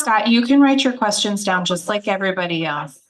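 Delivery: sharp rebuke. Real, not acted. Rebuking a heckler in crowd.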